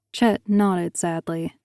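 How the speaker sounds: ordinary delivery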